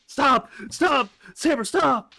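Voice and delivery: loud, high yelps of protest; mock pleading shout. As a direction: beg in mock panic for it to end. Pleads for the beating to stop.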